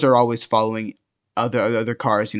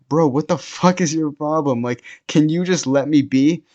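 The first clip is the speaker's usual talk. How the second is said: heated, pleading retold outburst; exasperated protest; 4 semitones higher than usual. Guy pushed to the ground protests to boyfriend, exasperated. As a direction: act out being fed up with a bully.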